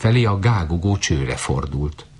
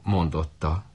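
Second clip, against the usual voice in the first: ordinary delivery, nothing marked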